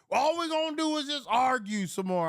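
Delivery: imitating the weary uncle; uncle's voice. Uncle complaining about arguing, mock voice. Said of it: "he's like".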